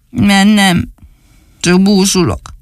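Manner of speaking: lightly voiced sulky little boy; sulky doll voice. Dani answers the dog from the tree, lightly voiced sulking. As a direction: mope the words out.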